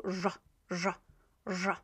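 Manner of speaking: "ż" as the zh in French bonjour; pronunciation demo. Shown as a demonstration. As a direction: repeat "ż" as an isolated buzzed zh. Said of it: "Almost like from the French".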